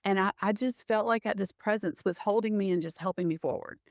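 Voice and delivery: normal talking voice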